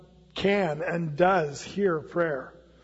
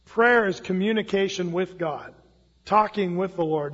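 ordinary talk, nothing marked

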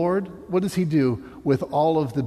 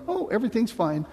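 mock cheerful; 7 semitones higher than usual. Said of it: "you have to be like".

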